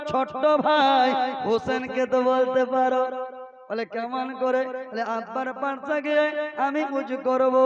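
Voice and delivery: loud, chanting sermon delivery; preaching at full force. Acted. Preacher telling the crowd about Hasan and Husain, full-force preaching. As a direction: preach it to the whole gathering.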